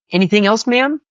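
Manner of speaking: high, perky order-taker; very high, polite server voice; fast food worker. Acted. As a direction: pipe up like a drive-through clerk. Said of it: "they're like".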